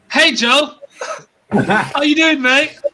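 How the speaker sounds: high, loud, laughing hello to a mate; excited welcome. Acted. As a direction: greet him like a long-lost friend. Welcoming a guest on the show.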